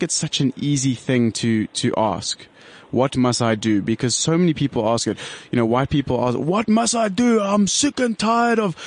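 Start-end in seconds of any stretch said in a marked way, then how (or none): 6.4-8.7 higher, drawn-out jock English put-on voice; imitation jock English complainer; jock English accent. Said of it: "that's not an Afrikaans accent".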